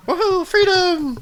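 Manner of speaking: very high whooping cheer; gleeful cheer. Acted. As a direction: shriek with joy as you run off. Character cheering as she runs.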